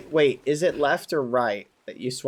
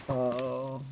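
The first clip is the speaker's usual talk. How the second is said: slower, soft and glum; mopey sad voice; 7 dB quieter, much slower than usual. Acted. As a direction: drag the words, all gloom. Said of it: "back in character".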